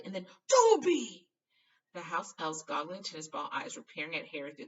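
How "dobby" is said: loud high startled call of a name; surprised cry. A narrator's reading. Harry recognizing the elf in the dark, startled. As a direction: gasp out the name in shock. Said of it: "Harry recognizes elf".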